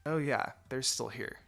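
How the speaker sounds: usual speaking voice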